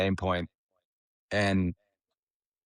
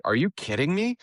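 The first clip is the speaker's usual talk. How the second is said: disbelief; 12 semitones higher than usual. Retold or imitated. Disbelief at Darren Clarke's question.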